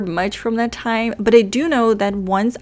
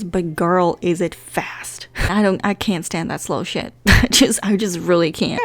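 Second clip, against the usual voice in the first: normal talking voice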